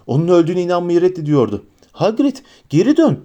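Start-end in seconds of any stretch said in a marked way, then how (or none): 1.9-3.2 high, loud command; urgent shout. A narrator's reading. Harry shouts at Hagrid over the engine, urgent. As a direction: order him to turn back.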